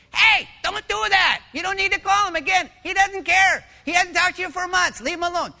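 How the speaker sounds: very high, loud tiny-person scolding; little purse voice. Acted. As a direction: squeak a loud warning like a tiny conscience. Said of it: "the little voice comes from the purse".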